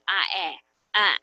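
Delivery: "ah, eh, ah" as separate short vowels; isolated vowel sounds. Shown as a demonstration. Teacher showing which sound the word has, isolated vowels. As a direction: sound out "ah", "eh", "ah" one by one. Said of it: "like that".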